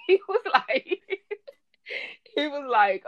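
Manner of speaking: helpless giggles mid-sentence; laughing. Real, not acted. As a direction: try to talk while cracking up. Cracking up while retelling client's story.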